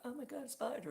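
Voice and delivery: high-pitched imitation of a frightened girlfriend; mock panic. Girlfriend spotting a spider in the room, mock panic. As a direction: squeal it in pretend alarm.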